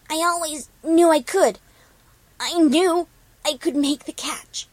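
high, loud, proud character voice; triumphant. Acted. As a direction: exult in the win. Character's triumph after catching lemon.

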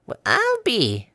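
high, very slow marveling; amazed old granny. A narrator's reading. Granny marveling at the Pegasus, high and slow. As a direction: drawl it out slowly, charmed.

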